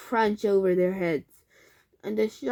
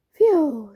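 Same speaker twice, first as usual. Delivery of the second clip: loud sigh of relief; exhausted relieved puff; relieved phew; 5 semitones higher, 8 dB louder than usual. A narrator's reading. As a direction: blow out relief. Relief after digging.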